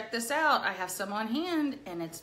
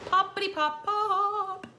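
very high, slow bouncy words; playful sing-song; 10 semitones higher, 4 dB louder, slower than usual. Acted. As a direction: make it pop like a cartoon. Playful sound word.

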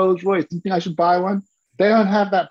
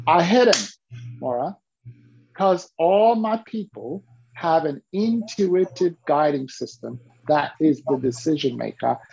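usual speaking voice

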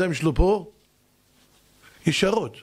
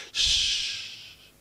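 long voiceless shhh; imitated extinguishing hiss; 5 dB quieter, much slower than usual. Man pouring water on wife's fire, long extinguishing hiss.

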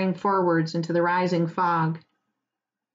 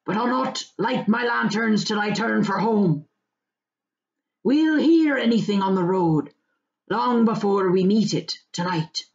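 put-on old farmer voice; 2 semitones higher than usual. Farmer warns the hobbits about fog, rustic voice. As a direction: weigh up the fog like a country farmer. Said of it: "said Maggot".

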